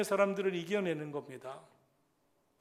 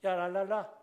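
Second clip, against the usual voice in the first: louder mock la-la taunt; teasing; 2 semitones higher, 7 dB louder than usual. Older brother mocking his tongues prayer, teasing. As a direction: imitate a brother's jeer. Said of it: "형이 그렇게 약 올렸어요".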